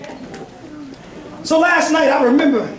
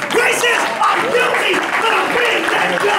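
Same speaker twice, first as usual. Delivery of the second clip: full-force preaching; 6 semitones higher than usual. Acted.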